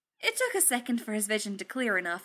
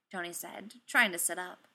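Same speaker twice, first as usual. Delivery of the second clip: plain reading voice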